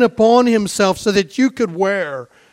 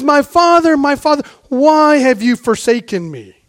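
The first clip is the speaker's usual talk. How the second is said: 1 anguished cry; 6 semitones higher, 6 dB louder than usual. Retold or imitated. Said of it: "He cried in anguish".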